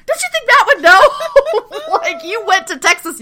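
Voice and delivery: high, loud disbelief through laughter; amused mock outrage; laughing incredulity. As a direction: protest as if it's glaringly obvious, cracking up. Mocks characters' disbelief, laughing.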